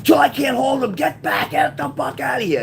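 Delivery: high urgent shout, easing at the end; acted-out scream. Cousin warning the woman to flee, re-enacted urgent scream. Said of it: "he's screaming to her".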